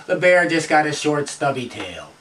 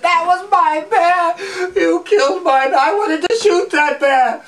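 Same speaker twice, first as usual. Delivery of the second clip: high-pitched, hammed-up tantrum yell; furious president throwing a squealing fit; outraged Teddy Roosevelt; 13 semitones higher, slower than usual. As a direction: wail in comic outrage, stretching the words. Enraged president swats his guide.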